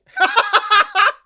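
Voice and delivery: high loud cackling laugh; loud burst of laughter. Real, not acted. Cohost cracks up at his own rant. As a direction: break into a big laugh.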